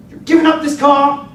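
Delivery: high, loud demand; loud threat. Retold or imitated. Carjacker demands the car from the teen driver, loud threat. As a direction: shout the demand like a robber.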